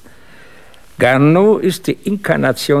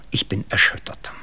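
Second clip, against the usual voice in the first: whispered and softer, shaken; hushed whisper; 7 dB quieter than usual. Acted. Gedämpft über Mordfall. (erschüttert geflüstert)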